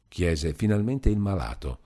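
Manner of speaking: usual speaking voice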